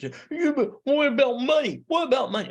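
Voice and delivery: impatient heckler. Acted. Lecturer voices a student demanding money, high and insistent. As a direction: pipe up high and insistent like a skeptic cutting in. Imagined objector interrupts the lecture.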